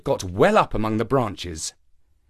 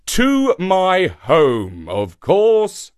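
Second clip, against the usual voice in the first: high, loud, very slow call; 6 semitones higher, 5 dB louder, much slower than usual. A narrator's reading. Shark answers monkey up in the tree, calling up. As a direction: stretch it out, calling upward.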